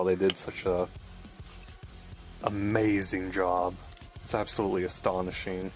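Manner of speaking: usual speaking voice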